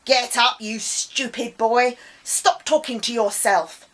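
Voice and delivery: loud scolding bark; angry shout. Acted. Angry adult scolding the boy, shouting. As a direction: yell at him to get up.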